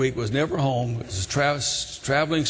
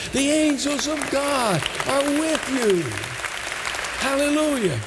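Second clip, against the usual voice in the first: high, ringing revival proclamation; fervent preaching; 10 semitones higher than usual. Real, not acted. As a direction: proclaim it to the congregation with rising pitch. Preaches at full force.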